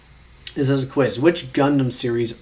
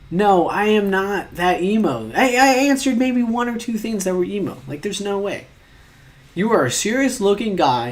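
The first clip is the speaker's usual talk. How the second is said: high-pitched, whiny objection; indignant protest; 6 semitones higher, 3 dB louder than usual. Real, not acted. Arguing with quiz calling them emo, indignant protest. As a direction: protest in a high, pleading wail.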